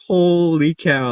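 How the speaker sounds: mock amazement; astonished. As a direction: draw it out in disbelief. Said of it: "I was like".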